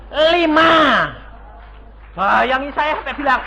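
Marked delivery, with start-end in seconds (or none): 0.1-0.7 loud, high, amazed. Real, not acted. Preacher seeing the cheque amount, loud amazement. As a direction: hit it with wonder.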